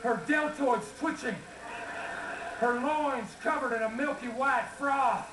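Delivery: folksy Texas twang; Texas accent. Acted. Folksy rancher telling family stories to a crowd, Texas accent.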